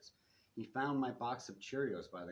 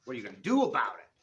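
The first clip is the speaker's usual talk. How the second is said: loud challenge; 2 semitones higher, 13 dB louder, much faster than usual. A narrator's reading. Character shouting a challenge.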